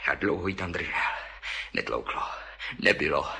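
hushed dread. Acted. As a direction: sink into a hushed dark murmur. Temné tiché vyprávění o vraždě. (tiše a hluboko, s hrůzou)

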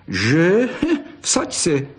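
mocking boy, rising and laughing; a jeering little challenge with a chuckle. A narrator's reading. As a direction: tease with a laugh. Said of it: "posmíval se Pepík".

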